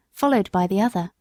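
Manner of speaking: ordinary delivery, nothing marked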